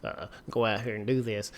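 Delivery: low, hushed, hesitant character voice; Eugene. Retold or imitated. Fan quoting a TV character to ma'am, low and hushed. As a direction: drop low and soft, haltingly polite. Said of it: "Eugene's just like".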